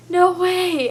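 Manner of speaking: "No way" high and loud; overjoyed disbelief. Retold or imitated. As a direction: burst out in happy shock. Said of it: "I was like".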